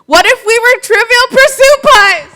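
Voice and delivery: high, loud and slow with delight; playful excitement. Real, not acted. Teacher pitching a fun idea to the audience, high and loud. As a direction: pitch it up big, savoring each word.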